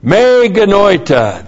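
Me genoita said slowly, high, stress on Me; Greek phrase. Shown as a demonstration. Teaching Greek to his congregation, careful pronunciation. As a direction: pronounce Me genoita as meh geh-NOY-tah. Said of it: "This is the negative me".

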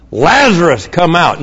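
Jesus calling Lazarus from the tomb, loud. Acted. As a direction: call a dead man out of the tomb. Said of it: "called out in a loud voice".

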